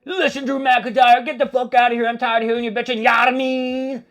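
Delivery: imitating Jacob Fatu. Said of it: "He's like".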